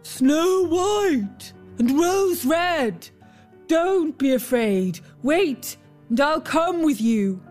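calling after them. A narrator's reading. Bear calling to the girls running away, drawn-out call. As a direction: stretch the names out high, then reassure. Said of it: "the bear called after them".

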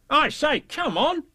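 high-pitched call; calling Tigger. A narrator's reading. Tigger to friends not following, high call. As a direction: hail them in Tigger's high voice.

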